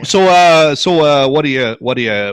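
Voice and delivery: folksy Yooper, starting loud and halting; Yooper accent. Pretend. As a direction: chat like a Yooper fisherman. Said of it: "[name]'s Yooper accent is better than mine".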